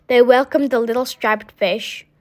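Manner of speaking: ordinary delivery, nothing marked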